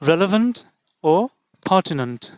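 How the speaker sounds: usual speaking voice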